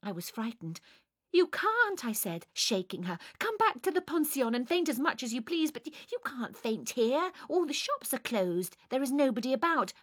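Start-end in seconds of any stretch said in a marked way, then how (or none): 1.3-2.1 alarm. A narrator's reading. Frightened, shaking her. 3.4-9.9 higher, hurried pleading; flustered urging. A narrator's reading. Frightened narrator urging her.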